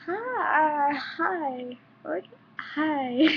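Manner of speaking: mock flustered hello; flustered greeting. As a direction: act caught off guard.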